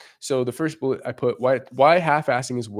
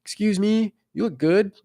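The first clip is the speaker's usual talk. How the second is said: high, timid little voice; meek guy; 8 semitones higher than usual. Retold or imitated. Imitating a meek guy approaching a girl, timid high voice. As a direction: squeak it out like a nervous guy. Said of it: "meekly, weakly walking over and going".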